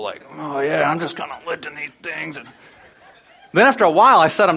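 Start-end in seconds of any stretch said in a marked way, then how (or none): 0.4-2.4 casual strain of looking cool, half laughing; playing it cool. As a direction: act nonchalant while lifting heavy weights. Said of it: "trying to look cool".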